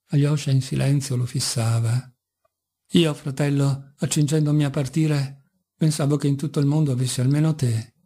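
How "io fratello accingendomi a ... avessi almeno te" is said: ordinary delivery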